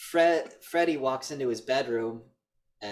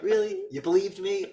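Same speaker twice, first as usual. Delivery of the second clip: mocking taunt; 8 semitones higher, 4 dB louder than usual. Killer mocking the mother.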